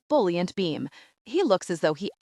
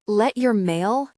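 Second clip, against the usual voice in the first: usual speaking voice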